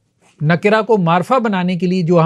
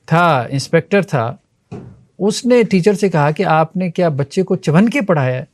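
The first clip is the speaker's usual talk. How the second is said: ordinary talk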